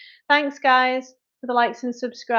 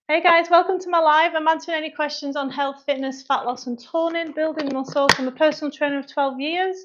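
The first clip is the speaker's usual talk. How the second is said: bright, higher-pitched intro patter; livestream welcome; 6 semitones higher than usual. Acted. Trainer welcomes viewers to the live, upbeat intro voice. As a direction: greet new viewers in a raised, rehearsed pitch.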